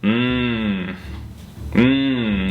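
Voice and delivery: hummed mmm of delight; mmm over pizza. Shown as a demonstration. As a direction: hum like tasting great pizza. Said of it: "you're gonna go".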